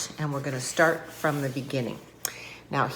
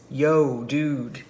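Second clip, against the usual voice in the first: low-pitched "yo, dude" prince; lowered voice, loud opening greeting; cool-dude prince; 4 semitones lower, 4 dB louder than usual. Acted. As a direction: play the prince as a laid-back dude. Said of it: "And here's the prince".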